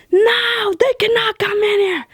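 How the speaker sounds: high-pitched imitation of a rude refusal; mock yelling manager. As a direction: mimic a bossy manager shutting them down. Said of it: "yelled at me".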